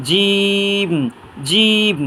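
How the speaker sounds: জিম with a very long, level "ee"; mid-palate, drawn out. Shown as a demonstration. Teacher models a letter for learners, stretched very long. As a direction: press mid-tongue to palate, then hold জিম. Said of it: "জিহ্বার মাঝখান তালুর মাঝখান থেকে".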